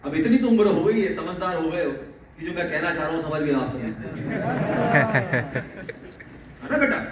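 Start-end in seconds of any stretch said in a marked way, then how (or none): 3.9-6.6 a low, warm chuckle. Real, not acted. Teacher chuckles at students after a point. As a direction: laugh along with the class.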